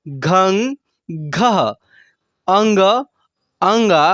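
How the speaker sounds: each syllable spoken slowly and separately; barakhadi recital. Shown as a demonstration. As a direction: sound out every syllable clearly for the class. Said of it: "ऐसे उच्चारण करेंगे". (हर अक्षर अलग-अलग साफ़ बोलना)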